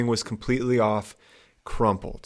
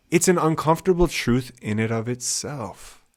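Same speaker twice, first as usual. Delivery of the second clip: ordinary delivery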